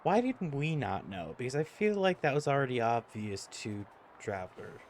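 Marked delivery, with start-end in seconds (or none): none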